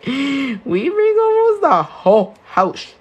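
amused high exaggeration; high drawn-out restatement. Real, not acted. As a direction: lift and drag the whole line, half laughing.

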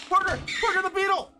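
high, loud, thrilled yelling; excited shout. Real, not acted. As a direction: shout it out with delight. Excited about found loot.

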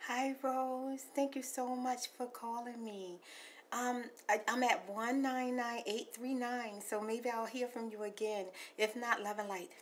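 soft hushed ASMR messages. Acted. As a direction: talk gently like ASMR to a caller. Soft ASMR voicemail talk.

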